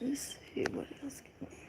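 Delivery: unvoiced whisper, fast; soft whispered search. Real, not acted. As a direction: breathe it to yourself while looking.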